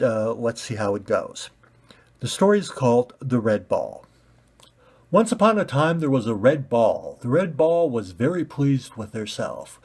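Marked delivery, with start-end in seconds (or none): 5.1-6.0 bright, high fairy-tale opener; storybook opening. Acted. Narrator opening the story with a lift. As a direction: start the tale like reading to kids.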